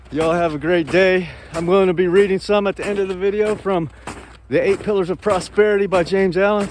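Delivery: loud, upbeat outro to viewers; loud sign-off. Real, not acted. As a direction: sign off big and cheery.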